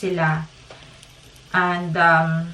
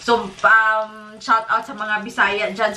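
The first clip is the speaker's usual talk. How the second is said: ordinary talk, nothing marked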